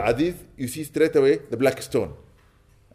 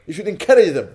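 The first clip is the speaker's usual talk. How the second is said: high, louder mock scolding; mock rebuke pitched up high and louder; retold rebuke; 8 semitones higher, 8 dB louder than usual. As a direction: scold the brother in a raised voice, half playful. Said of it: "I said".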